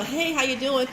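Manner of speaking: cheery phone greeting. Shown as a demonstration. She shows how to phone a friend, a loud laughing hello. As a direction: greet a friend like you're glad they called.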